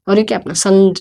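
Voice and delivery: ordinary talk, nothing marked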